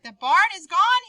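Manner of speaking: high, loud alarmed cry; teacher's loud mock scream. Shown as a demonstration. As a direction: yell out the bad news. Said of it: "he screamed".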